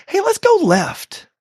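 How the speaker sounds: imitating wife, very high and drawn out; breezy suggestion. Wife suggests another route to husband, breezy suggestion. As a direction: sing it out high, mock-cheery.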